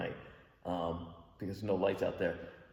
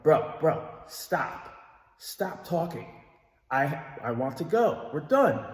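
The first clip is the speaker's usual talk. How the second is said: louder, higher mock urging; retold push to leave now, raised; telling the drunk friend they're done, higher and louder; impatient urging; 5 semitones higher, 4 dB louder, much slower than usual.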